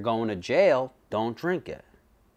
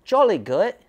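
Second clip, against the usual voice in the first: hammed-up British accent, pitched higher; mock British accent; 9 semitones higher, 3 dB louder than usual. Mocking British people who eat canned macaroni, in a jokey British accent. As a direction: play a jolly British toff with a British accent. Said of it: "They're like, British people".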